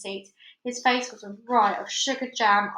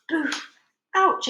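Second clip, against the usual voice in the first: high, loud comic ouch after a thud; alien's comic yelp; bump and ouch; 7 semitones higher, 4 dB louder than usual. Acted. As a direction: cry out from a hard knock. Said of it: "it cried".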